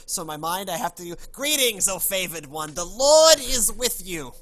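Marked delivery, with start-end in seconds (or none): 1.3-4.3 put-on New York accent. Playing angel Gabriel greeting Mary, loud New York accent. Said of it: "I play him as a New Yorker".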